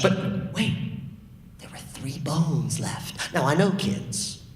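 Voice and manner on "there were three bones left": hushed whisper. A narrator's reading. Hushed story suspense.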